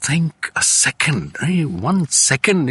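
slow and deliberate. Shown as a demonstration. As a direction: weigh each word slowly. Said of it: "percolated my psyche".

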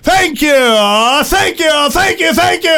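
very slow, high, shouted announcer voice; milk each word like a ring announcer. Acted. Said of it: "in his very best announcer voice".

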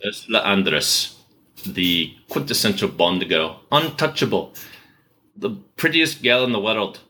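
Scottish accent imitation; amateur Scottish film-character impression; Sick Boy. Shown as a demonstration. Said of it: "Can I just do my Sick Boy imitation?".

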